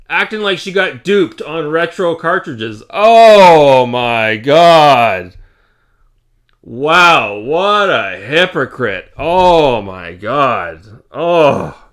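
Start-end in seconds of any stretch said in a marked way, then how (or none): 2.9-11.9 very slow, loud drawn-out oh my God; exasperated groan. Real, not acted. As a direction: stretch it out in disbelief. Ranting in disbelief.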